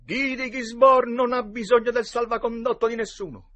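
high, loud mock-heroic retort; boastful challenger. A narrator's reading. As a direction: proclaim yourself defiantly, at full voice. Boys act out outlaw challenge. (sfidante spavaldo, a gran voce)

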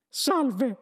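high-pitched little mouse greeting. A narrator's reading. Mouse being introduced to Arthur, high mouse voice. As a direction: squeak it like a mouse. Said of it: "fece uno dei topi".

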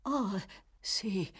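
ordinary delivery

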